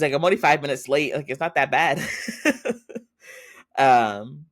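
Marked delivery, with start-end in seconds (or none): 0.1-2.0 louder, quicker defensive protest. Retold or imitated. Girlfriend defending being late. 2.0-3.0 a high airy laugh; breathy laugh. Real, not acted. Laughing at the story.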